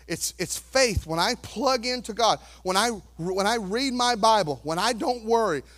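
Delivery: normal talking voice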